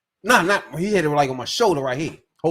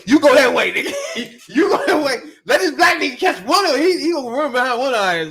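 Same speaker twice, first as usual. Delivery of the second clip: laughing, high, loud mock command; hammed-up yelling orders; mock barked orders; 10 semitones higher, 5 dB louder than usual. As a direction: shout it half-laughing like bossing the crew. Mock orders in a chase story.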